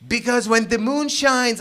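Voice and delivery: mumbled Trump impression. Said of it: "and he then mumbles".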